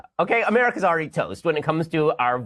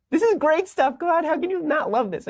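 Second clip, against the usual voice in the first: much higher, squealing with glee; squeaky delight; 12 semitones higher than usual. Real, not acted. Host gushing over the tweet in a high squeal.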